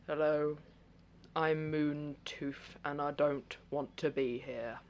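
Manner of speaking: slow, quiet and reluctant; slow, soft, unwilling orc; slow character voice. Acted. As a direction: drag the words out like you'd rather not talk. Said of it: "it's probably gonna be a bit slow".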